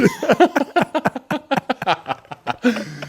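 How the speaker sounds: long high-pitched laugh; burst of laughter. Real, not acted. Co-host after own crumpet joke, high real laughter. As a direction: crack up helplessly at your own joke.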